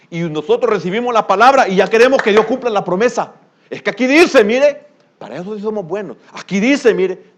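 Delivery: loud, high preacher's rebuke; fiery preaching. Acted. Preacher rebukes impatient believers loudly. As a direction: press the point hard.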